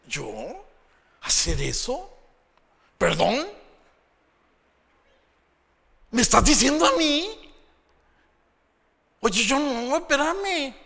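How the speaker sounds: mock offended, high and loud; haughty refusal. A touch of a proud person brushing off a request. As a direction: play the snob asked to work.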